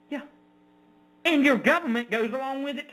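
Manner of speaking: ordinary talk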